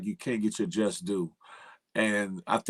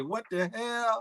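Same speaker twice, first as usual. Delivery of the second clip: baffled what the hell; 12 semitones higher, 4 dB quieter, slower than usual. Retold or imitated. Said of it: "I was say".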